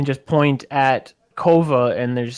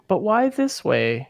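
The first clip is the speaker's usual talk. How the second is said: whimsical; 7 semitones higher, slower than usual. Real, not acted. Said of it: "the whimsy in [name]'s voice".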